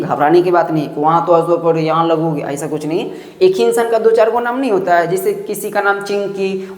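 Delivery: usual speaking voice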